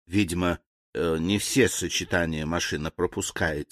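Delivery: low, soft, composed servant; calm reply. A narrator's reading. Servant explains the machine fault to his master, calm. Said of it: "спокойно ответил слуга".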